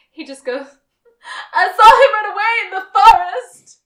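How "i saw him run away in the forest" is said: high, loud mock cry with laughter in it; mock shout, pitched way up and half laughing; hammed-up yell. Podcaster re-enacts a character's last desperate outburst, hammed-up yell. Acting out Sean's yelled parting line.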